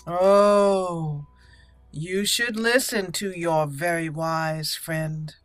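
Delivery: ordinary talk, nothing marked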